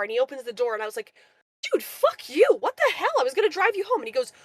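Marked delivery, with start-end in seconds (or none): 1.7-4.0 retold furious comeback, much higher and louder; angry retort. Driver snapping back at rude passenger, furious yell. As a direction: fire it back at him, outraged.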